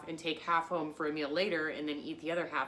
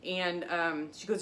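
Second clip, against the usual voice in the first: usual speaking voice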